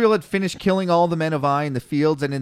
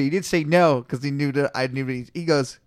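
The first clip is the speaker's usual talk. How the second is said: ordinary delivery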